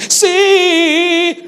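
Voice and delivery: high, loud hammed-up yes; a worshipper overdoing his answer; emphatic yes. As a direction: shout the yes.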